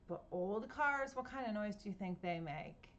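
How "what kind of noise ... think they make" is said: ordinary delivery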